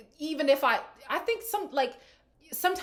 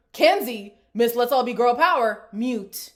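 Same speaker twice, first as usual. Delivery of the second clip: louder, mocking emphasis; sarcastic callout; 10 dB louder than usual. Real, not acted. Host mocking Kenzie's girl power image, sarcastic. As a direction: throw shade at her title.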